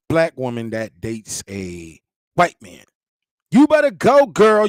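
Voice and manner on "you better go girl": cheering women. Retold or imitated. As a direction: hype her up like a friend.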